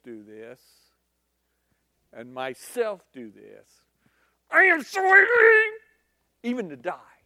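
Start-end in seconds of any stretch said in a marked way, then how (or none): 4.5-7.2 very high crying wail; loud high cry fading to a sulk; tantrum cry. Acted. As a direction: wail like a three-year-old in a tantrum. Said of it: "I've seen three-year-olds do this".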